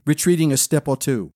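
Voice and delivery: ordinary delivery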